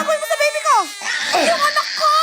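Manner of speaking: put-on high, fast, shouted crying for her child; frantic mother crying out for her baby; panicked wail. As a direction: scream in panic for your baby.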